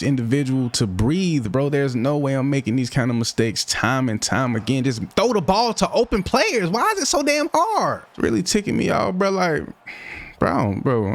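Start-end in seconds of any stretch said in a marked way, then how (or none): 4.8-9.6 much higher, strained angry venting; frustrated outburst. Real, not acted. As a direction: vent at yourself, high and tight. Angry at his own bad throws.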